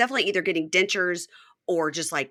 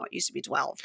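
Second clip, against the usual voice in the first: ordinary delivery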